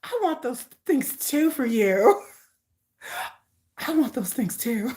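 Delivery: tender. Real, not acted. Tender reply after laughing.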